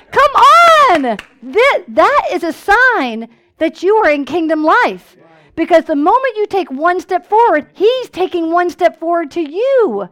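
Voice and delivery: loud, high, rising preacher's call; preaching at full force. Acted. Pastor fires up the church about kingdom blessings, preaching at full force. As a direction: lift the congregation up with each line.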